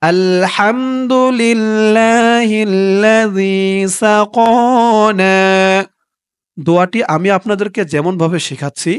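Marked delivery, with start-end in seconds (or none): 0.0-5.4 slow, drawn-out chanted recitation; recited Arabic dua. Shown as a demonstration. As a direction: stretch every syllable like tilawat. Model recitation. (ধীর সুরেলা তিলাওয়াত)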